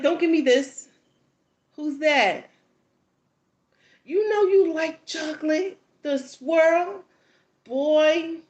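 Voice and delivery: high, loud sassy tease; sassy teasing. Retold or imitated. Mom teasing the boy about liking chocolate, sassy and loud. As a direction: tease him with attitude.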